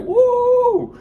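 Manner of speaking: very high, loud whoop; mock crowd whoop; cheering woo. Females clapping and cheering.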